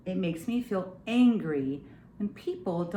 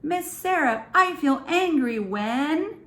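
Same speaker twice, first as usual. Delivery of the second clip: put-on child's prompt; 7 semitones higher, 4 dB louder than usual. Shown as a demonstration. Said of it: "can you tell me something".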